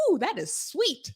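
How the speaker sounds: high, slow, drawn-out ooh of surprise; acted sweetness shock. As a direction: play being hit by too much sugar. Acts out reaction to sweetness.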